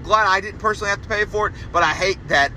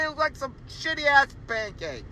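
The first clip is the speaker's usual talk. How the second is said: normal talking voice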